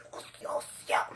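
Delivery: breathless secret whisper of joy. Retold or imitated. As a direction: hush it like hiding your delight. Inner glee, kept secret.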